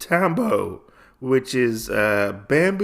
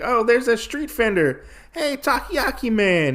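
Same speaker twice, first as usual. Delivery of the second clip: high-pitched hail to a vendor; mock calling out; 11 semitones higher than usual.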